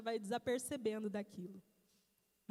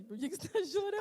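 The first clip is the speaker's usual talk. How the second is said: imitating husband; 6 semitones higher, faster than usual. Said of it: "Aí ele olha pra mim assim".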